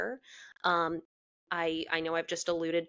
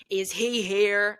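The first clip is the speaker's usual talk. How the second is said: slow, louder demand at the host stand; stormed-in demand, louder and slower; 6 dB louder, slower than usual. Retold or imitated. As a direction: confront the host, each word deliberate. Storming in to confront him.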